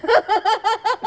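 real laughter bursting out high. Real, not acted. Laughing at the woman who claimed a legion was chasing her. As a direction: break into a real laugh mid-story. Laughs retelling a complainant's story.

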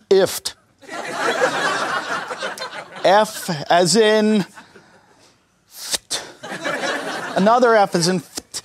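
nervous, as if tested. Retold or imitated. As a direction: spell it haltingly, like a flustered test-taker. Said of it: "So I get all nervous".

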